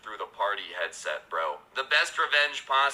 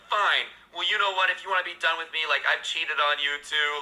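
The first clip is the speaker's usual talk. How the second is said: higher-pitched mock exasperation; imitated angry retort; 5 semitones higher than usual. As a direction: play a girlfriend lashing back in a quarrel. Girlfriend's angry retort in retold quarrel.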